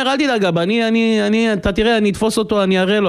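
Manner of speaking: very high-pitched, stammering bravado; cocky boy. Retold or imitated. Son tells father he will catch the Cossack, cocky. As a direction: boast in a small boy's voice.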